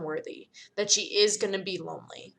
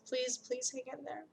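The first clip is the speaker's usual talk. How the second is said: much softer, higher pleading please; 5 semitones higher, 12 dB quieter than usual. Acted. Serafina begs crying Karenina to hang on, softly. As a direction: beg her gently to hold on. Tearful plea to crying friend.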